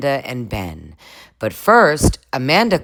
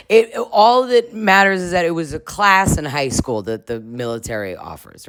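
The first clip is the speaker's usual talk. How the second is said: ordinary talk, nothing marked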